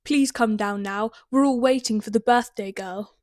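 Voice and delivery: loud, booming call up the stairs; booming uncle. A narrator's reading. Uncle calls his niece downstairs, booming. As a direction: boom it out like a big uncle. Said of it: "A deep, booming voice".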